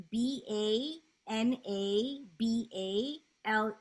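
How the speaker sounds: slow letter-by-letter spelling; spelled out slowly. Shown as a demonstration. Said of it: "could you spell the plant".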